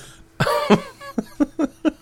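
squealing high laughter. Real, not acted. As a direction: shriek with laughter. Laughing hard.

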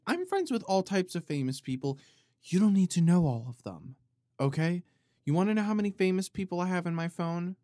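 high, loud, haughty put-on boast; loud, high mock-arrogant rant; mock diva brag. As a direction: play the snooty celebrity showing off. Mock diva boasting about famous friends.

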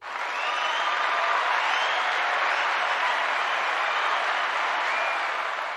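drawn-out breathless gasp; long awed intake of breath; long amazed gasp. Retold or imitated. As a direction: gasp like you just won an award. Reacting to being chosen.

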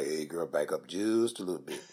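low seductive murmur, lightly done. Retold or imitated.

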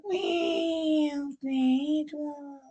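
high, soft, sleepy cat mews; drowsy high kitty meows; waking cat meows. Acted. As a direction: mew like a cat just waking. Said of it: "Waking up".

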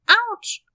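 high, loud sudden ouch; sharp high kitten yelp of pain. Acted. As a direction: cry out from the fall. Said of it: "hörte man sie schreien".

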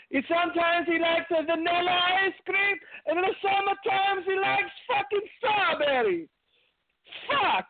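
put-on high, hammy Italian accent griping about ice cream; Pat Patterson impression. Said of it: "doing his impression of Pat Patterson".

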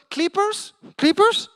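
high, loud foreign-sounding "Clippers?", said "kleepers"; high rising "Clippers?" as "kleepers"; imitated drunk man. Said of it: "drunk guy comes up".

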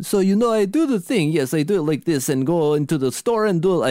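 hammed-up Slavic accent with a lift. Shown as a demonstration. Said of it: "that, like, Slavic accent all the time".

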